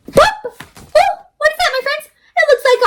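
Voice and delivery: high, amazed oh; surprised pop. Acted. Reading to children, amazed at the blob. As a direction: act amazed for the kids.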